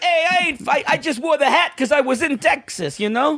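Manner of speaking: high-pitched New York protest; caricatured New Yorker on the defensive; put-on New York voice, half laughing; New York accent. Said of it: "He's from New York".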